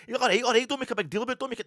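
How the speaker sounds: loud, high-pitched mock snapping; retold irritated retort. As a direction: imitate the man brushing her off at volume. Man snapping back in retold quarrel.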